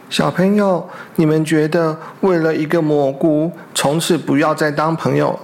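plain reading voice

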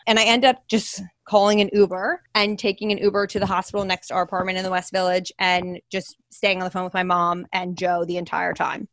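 ordinary delivery

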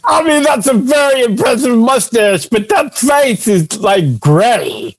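high-pitched alarmed plea; frantic pleading. Acted. Alarm over gray-faced passenger.

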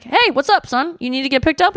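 a hint of a dad answering the phone; casual dad on the phone, lightly played; lightly voiced dad. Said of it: "dads being like".

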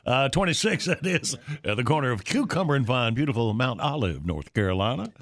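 ad read. Acted. Sponsor read trailing deeper.

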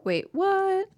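sudden high mock surprise; a quick, startled wait-what; surprised. Feigned surprise at versatile benzos.

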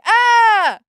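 high loud shocked shriek; a shrill yelp of disbelief. Retold or imitated. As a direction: scream in delighted shock. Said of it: "And I was like".

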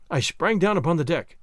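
ordinary delivery

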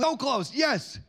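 high, loud cheer for a guess; excited encouragement. Real, not acted. Host reacts to kids' riddle guesses, excited and loud.

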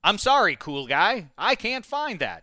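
high, measured voice-assistant delivery; Siri. Retold or imitated. Host imitating his phone calling him cool guy, robotic assistant. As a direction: apologize like a phone assistant, slowing at the end. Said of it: "I have my Siri, uh, call me cool guy".

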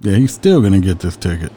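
normal talking voice

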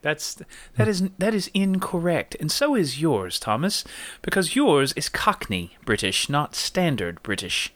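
measured, put-on British; standard British accent. Shown as a demonstration. Host correcting players on their accents, standard British accent. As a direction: pronounce the verdict in proper British. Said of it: "We're gonna go standard British".

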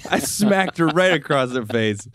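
ordinary talk

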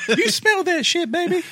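high put-on sleazy voice; silly high voice. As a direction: ham it up in a squeaky voice. Joking put-on voice after laugh.

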